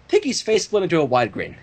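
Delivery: ordinary delivery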